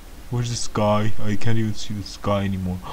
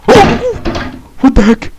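real fright, a shriek far higher and louder; startled scream; 10 dB louder, slower than usual. Real, not acted. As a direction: jump out of your skin and yell it. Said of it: "Oh, scared me".

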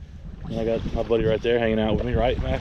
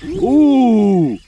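excited; 7 semitones higher, 6 dB louder, much slower than usual. Real, not acted. Watching the bull red run.

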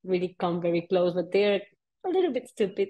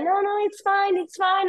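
high, breezy reassurance; mock carefree guide, pitched high; dismissive guide; 12 semitones higher than usual. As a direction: imitate the guide brushing it off.

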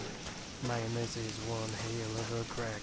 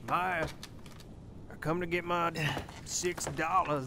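raised, higher-pitched call; 6 semitones higher than usual. Acted. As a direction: call down to someone out of sight. Calling down for Maya.

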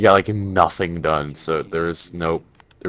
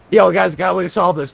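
excited; 11 semitones higher, 6 dB louder than usual. Real, not acted. Hyped call to viewers.

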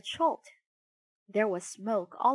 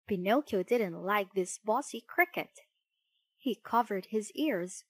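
plain reading voice

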